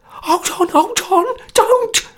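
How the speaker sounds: very high, loud panicked cry; a terrified man begging them not to mix it; cry of terror. A narrator's reading. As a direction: scream for them to stop in terror. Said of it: "cried Sarcher in a sweat of terror".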